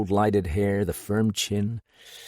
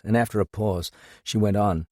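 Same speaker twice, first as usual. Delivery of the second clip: usual speaking voice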